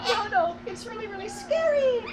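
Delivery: high whimpering mock fright; mock scared. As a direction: play at being scared. Hammed-up fright, second take.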